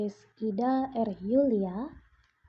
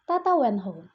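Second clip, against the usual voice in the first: plain reading voice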